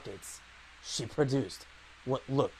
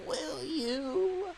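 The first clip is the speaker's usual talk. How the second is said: put-on grumpy old man. Old woman voice in dub.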